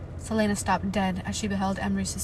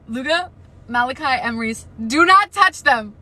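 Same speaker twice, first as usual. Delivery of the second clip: loud, higher pleading command; urgent yelling; 5 semitones higher, 10 dB louder than usual. Real, not acted. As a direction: yell the order at the page. Warning the characters.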